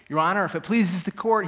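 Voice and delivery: prosecutor's address. Acted. Prosecutor rising to address the judge, projected formal address.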